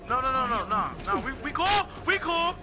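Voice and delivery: high, loud frightened pleading; panicked pleading. Real, not acted. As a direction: beg in a panic. Monster close.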